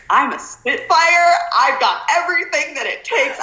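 defiant self-talk. Retold or imitated. Retold defiant thought.

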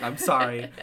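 ordinary talk, nothing marked

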